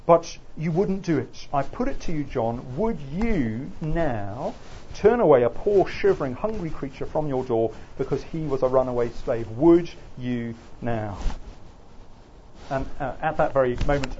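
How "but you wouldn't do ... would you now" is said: lecturer acting an indignant wife, slowing heavily on the challenge; Mary Bird. Wife interrupts her senator husband over runaway slaves, impassioned challenge. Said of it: "and Mary interrupts".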